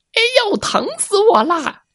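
high wailing fox yelp; fox crying in pain. Acted. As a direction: howl it like a hurt fox.

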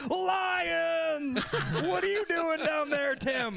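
high, drawn-out cry with laughter behind it; hammed-up high-pitched shock; mock anguished yell. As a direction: yell your own surname in absurd grief. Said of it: "like, you yelling your last name".

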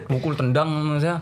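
plain reading voice

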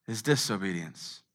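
ordinary delivery

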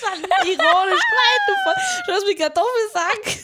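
laughing high and loud. Real, not acted. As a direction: talk through laughter. Laughing after friend's shocked reaction.

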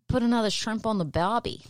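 hammed-up Australian catchphrase; mock Australian. Co-host quoting what Australians love to say, mock Australian accent. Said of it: "They love to say".

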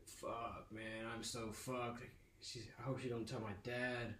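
muttering worriedly to himself; quiet dread. Retold or imitated. Son alone fearing mom tells dad, quiet dread.

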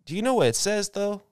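high, loud teasing question; sly mock question in a high bro voice. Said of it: "He's like".

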